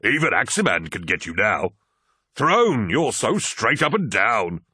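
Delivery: amused teasing. Acted. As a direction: tease your gullible friend loudly. Torgadon teasing fooled friend, all laughing.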